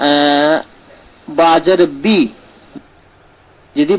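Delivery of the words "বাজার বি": বি with a long, pure i; maruf vowel. Shown as a demonstration. Teacher giving students a second example, maruf vowel. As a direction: say বি with a clean ee sound. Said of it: "মারুফ, এটাই ঠিক".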